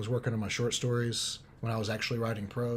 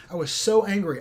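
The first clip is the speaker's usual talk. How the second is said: usual speaking voice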